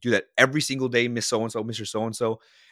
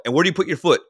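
loud and higher quiz; coach quizzing; 7 semitones higher, 6 dB louder, much faster than usual. Retold or imitated. Coach checking his hitter's learning, loud and higher. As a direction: demand the answer from the kid. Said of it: "forceful voice".

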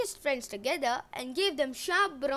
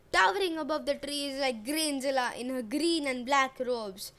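ordinary delivery, nothing marked

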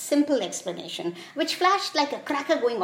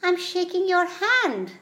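high, slow, innocent little reply; 8 semitones higher than usual. A narrator's reading. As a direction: say it slowly and innocently, pitched up. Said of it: "looked up innocently and said".

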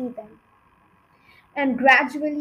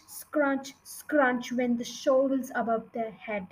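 plain reading voice

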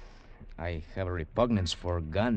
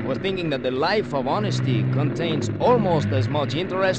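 raised voice over the engine; raised, higher voice, wry, over the engine roar; loud reply; 6 semitones higher, 8 dB louder than usual. Acted. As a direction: call it out over the motor noise. Answers over the boat engine.

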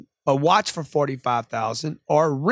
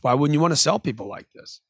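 usual speaking voice

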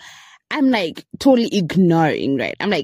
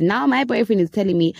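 ordinary talk, nothing marked

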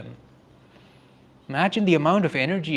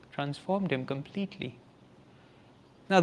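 ordinary talk

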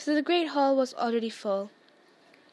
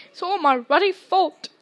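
sobbing; 4 semitones higher, 6 dB louder than usual. A narrator's reading. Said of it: "he sobbed".

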